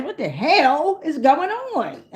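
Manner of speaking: slow, drawn-out disbelief; exaggerated bafflement. Real, not acted. Talking to the energy outside, baffled and drawn-out.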